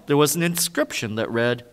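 normal talking voice

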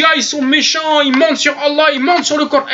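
plainte imitée, voix plus haute; Ayman Teher. Retold or imitated. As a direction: geindre en caricaturant un accusateur vexé. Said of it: "Il dit". (plainte moqueuse, haut perchée)

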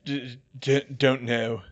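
stammered, halting reply; weakly stuttered character line; muttering Harry. A narrator's reading. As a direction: stumble on the first sound before answering. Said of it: "he muttered".

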